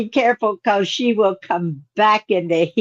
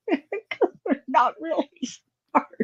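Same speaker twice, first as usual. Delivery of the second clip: laughing; 6 semitones higher, 6 dB quieter, slower than usual. Real, not acted. Laughing at people by window.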